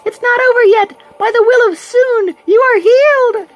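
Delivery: very high, bright heroic cry; high girly voice. Acted. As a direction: squeak it out as a cute heroine. Sayori heals Kirito again.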